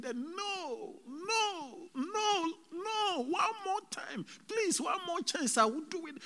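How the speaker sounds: very high-pitched, wailing cries; screaming plea. Retold or imitated. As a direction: re-enact a desperate, crying scream for mercy. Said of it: "so I was screaming".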